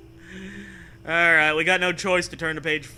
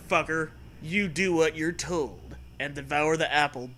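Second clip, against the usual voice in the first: normal talking voice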